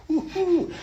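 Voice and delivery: high squealed cheer; mock woo-hoo. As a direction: toss off a mock celebration. Mock celebration.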